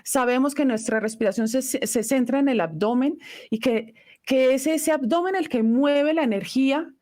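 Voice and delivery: ordinary delivery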